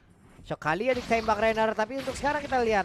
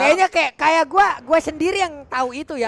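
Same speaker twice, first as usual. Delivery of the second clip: laughing; 8 semitones higher, 9 dB louder than usual. Real, not acted. Laughing embarrassed admission.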